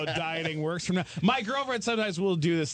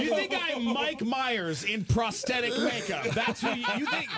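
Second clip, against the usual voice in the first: high, incredulous comic rant; mock outrage; 5 semitones higher than usual. Comic confronts the fan over the makeup, mock outrage. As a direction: sputter in exaggerated offence. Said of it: "And I was like".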